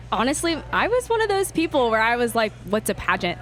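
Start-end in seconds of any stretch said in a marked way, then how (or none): none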